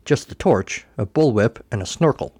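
plain reading voice

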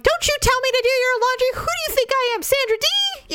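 high-pitched, rapid indignant rant; mock screech of an outraged wife; fly off the handle, voice shooting way up; mad outburst. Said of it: "get mad immediately".

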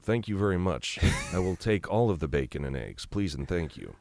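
blank, relaxed-throat head-cold tone; Ron Swanson. Shown as a demonstration. Voice actor demonstrates his nasal character tone, deadpan. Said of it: "that sort of Ron Swanson tone".

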